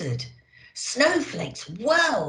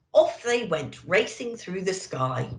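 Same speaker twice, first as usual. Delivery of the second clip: ordinary delivery, nothing marked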